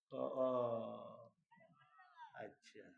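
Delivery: soft, sheepish little oh, I see; sheepish onlookers. Retold or imitated. Lift passengers realising what the sign says, sheepish and quiet. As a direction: play the embarrassed bystander.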